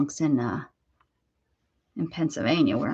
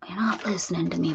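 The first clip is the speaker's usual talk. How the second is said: dog's moan; faster than usual. Retold or imitated. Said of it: "hear him moan like".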